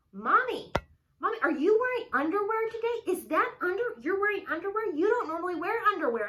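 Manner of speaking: put-on high, loud, nosy child; loud little girl. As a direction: shout it high like a curious kid. Daughter questioning mom loudly.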